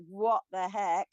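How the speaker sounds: ordinary delivery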